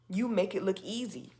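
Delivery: ordinary talk, nothing marked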